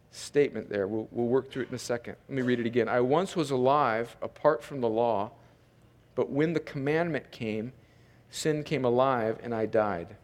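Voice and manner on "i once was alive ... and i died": low, soft, measured re-reading; scripture reading. Real, not acted. Pastor rereads a complex verse, hushed scripture reading. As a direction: read it softly, sinking lower to the end.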